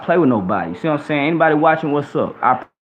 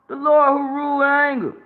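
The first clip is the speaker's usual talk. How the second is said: high preaching cry; 11 semitones higher than usual. Real, not acted. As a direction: sustain the fervent high pitch. Preacher repeating, climbing in fervour.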